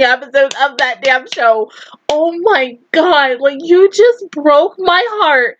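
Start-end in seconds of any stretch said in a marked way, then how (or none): none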